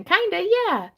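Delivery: bright high kinda yeah; excited. Real, not acted. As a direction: pop up with excitement. Excited reply to comment.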